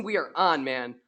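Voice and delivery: ordinary talk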